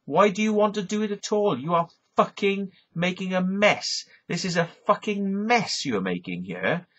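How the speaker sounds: mock-yell at the farmer; retold angry rant. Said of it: "And I said".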